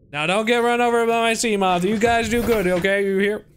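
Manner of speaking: cooing farewell to released fish; fussing parent voice. Pretend. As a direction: talk to the little fish like a protective mom. Sending released fish off kindly.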